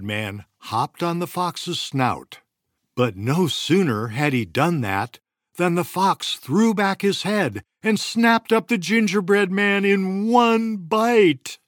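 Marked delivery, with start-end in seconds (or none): 2.9-11.6 dramatic climax. A narrator's reading. Climax of story.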